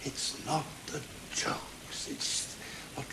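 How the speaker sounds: It's not the jokes whispered, slow, stress on not. Acted. Teacher whispering to his comedy students, slow. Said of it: "repeated line".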